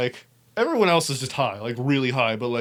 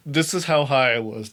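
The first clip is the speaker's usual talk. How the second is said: normal talking voice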